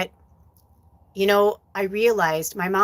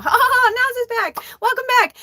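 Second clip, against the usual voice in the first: very high, fake cheerful kid voice; nervous kids faking a happy welcome; forced cheer; 4 dB louder than usual. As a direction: cover the fear with brightness. Nieces forcing cheer.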